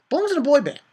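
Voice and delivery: high, loud scornful jab; ranting outburst. Real, not acted. Fan rants about a hated character, loud scorn. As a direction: throw it out high and loud in contempt.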